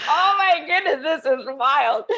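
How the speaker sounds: loud, high astonishment; retold wide-eyed amazement; amazed exclamation. Retold amazed reaction, laughing around.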